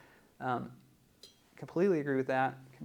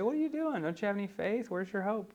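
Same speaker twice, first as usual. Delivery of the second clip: mock chastising; 6 semitones higher, 3 dB quieter than usual. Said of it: "doesn't chastise her and say".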